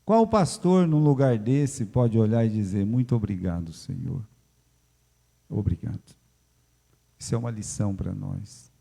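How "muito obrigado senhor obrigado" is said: grateful prayer. Retold or imitated. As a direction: pray with humble thanks. Imagined grateful pastor praying.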